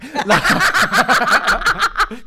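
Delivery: high loud burst of laughter; real high cackling laugh; big laugh. Real, not acted. Laughing at drunk neighbour.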